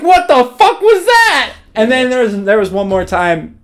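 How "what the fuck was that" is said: high, slow and louder, stunned; shocked disbelief. Retold or imitated. Rattled friend after roommate's sleep talk, high stunned exclamation. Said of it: "I was like".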